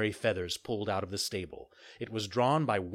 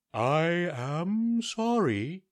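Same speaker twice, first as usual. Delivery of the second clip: very slow, higher owl apology; owl doctor; 7 semitones higher, much slower than usual. Acted. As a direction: drag out the owl's polite regret. Owl doctor begins to speak.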